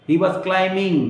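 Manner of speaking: climbing as "cli-ming", no B; only an M in the middle of climbing; silent B. Shown as a demonstration. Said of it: "not climbing".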